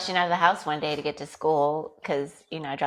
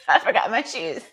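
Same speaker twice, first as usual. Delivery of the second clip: laughing retelling; 4 semitones higher, 3 dB louder than usual. Retold or imitated.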